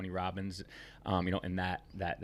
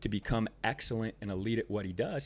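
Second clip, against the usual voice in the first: normal talking voice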